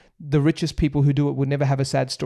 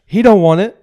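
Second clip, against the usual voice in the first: American accent; 5 semitones higher, 11 dB louder than usual. Shown as a demonstration. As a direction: punch it out like an American hooper. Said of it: "gonna do my best American accent".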